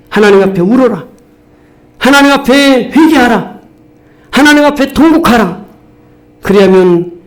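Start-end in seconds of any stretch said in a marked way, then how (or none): 0.1-5.6 preaching commands. Real, not acted. Exhorting commands.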